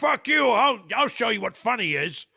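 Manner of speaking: high, loud hammed-up retort; exaggerated combative comeback; mock outrage. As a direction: imitate an indignant shouter. Acted mock angry reply.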